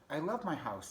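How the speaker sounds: high-pitched storybook mouse; little mouse. Acted.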